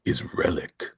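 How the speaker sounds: low, deliberate closing line; Boyette impression. Host ending the tagline as Boyette would, low impression. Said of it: "my best impression of the way".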